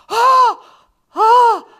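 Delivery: two loud, high mock gasps; shocked gasps. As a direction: gasp dramatically, one after the other. Imitates shocked reaction in wash house.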